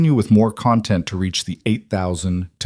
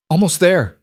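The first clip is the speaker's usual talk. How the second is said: panted; 9 semitones higher, 4 dB louder than usual. A narrator's reading. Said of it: "Thomas panted".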